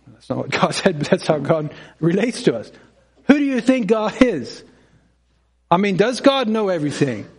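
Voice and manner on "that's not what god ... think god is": ordinary talk, nothing marked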